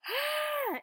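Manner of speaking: sharp high startled yelp; a quick panicked yelp at the desk; startled gasp. Retold or imitated. Panicked desk worker yelps at a name.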